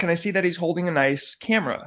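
ordinary delivery, nothing marked

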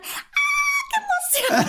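very high laughing squeal; mock girlish squeal of joy; squealing excitement. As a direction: shriek with glee like the girls. Girls jumping excited.

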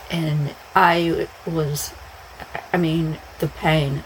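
normal talking voice

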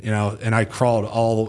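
ordinary talk

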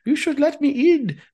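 whiny hammed-up German accent; [name]'s German accent. Still outside pleading to get in, mock German accent. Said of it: "not as inappropriate as his German accent".

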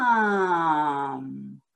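Mom stretched out very slowly; slow like a turtle. Shown as a demonstration. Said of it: "Here's how you say it slowly".

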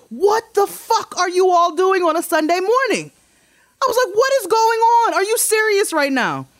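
high, loud furious rant; retold yelling at the truck crew; retold angry yelling. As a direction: confront the workers at full volume. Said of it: "I was louder than the concrete truck".

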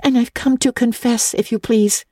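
put-on high girl's voice, pleading; a child owning up, hands clasped; earnest little girl. A narrator's reading. Anne confesses to Miss Barry, earnest and high. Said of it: "clasping her hands with her characteristic gesture".

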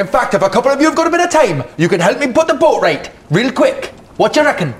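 high, quick Geordie bosun; matey Geordie handyman asking a favour; attempted Geordie accent. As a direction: pitch it up in a put-on Geordie. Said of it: "He's supposed to be Geordie".

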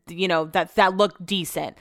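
ordinary delivery

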